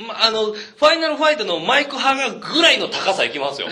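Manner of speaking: laughing. Real, not acted. Boasting jump height while laughing.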